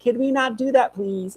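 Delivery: dog's voice. Pretend. Pet dog complaining to his dad, pleading. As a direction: plead like a reluctant pup. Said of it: "He's like".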